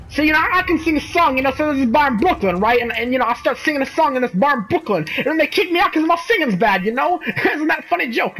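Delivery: high, hammy Brooklyn comic; Brooklyn accent. Acted. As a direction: Brooklyn stand-up with bad jokes. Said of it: "now he has a Brooklyn accent".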